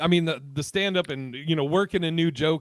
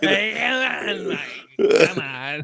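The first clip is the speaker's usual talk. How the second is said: stretched-out catchphrase in a put-on voice; catchphrase impression; 3 semitones higher, much slower than usual. Teasing comic doing his friend the bit, drawn-out catchphrase voice. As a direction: drag out each word like a catchphrase. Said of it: "I was gonna do the come on guy".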